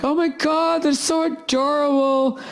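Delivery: high, slow gushing voice of the lady; mock the airheaded woman cooing over cubs; ditzy gushing lady. Comic mocks the woman gushing over baby lions, high and cooing. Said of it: "She's like".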